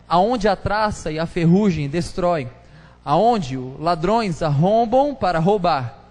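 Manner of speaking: loud, measured Bible reading from the pulpit; solemn, forceful reading of Matthew; scripture reading. Acted. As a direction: proclaim each verse to the church. Reads scripture aloud.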